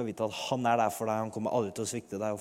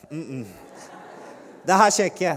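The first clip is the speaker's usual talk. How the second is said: louder flustered protest to God; panicked refusal; 7 dB louder, slower than usual. Retold or imitated. Afraid to approach a huge man, protesting loudly to God. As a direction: balk out loud at the idea. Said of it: "jeg sier med en gang".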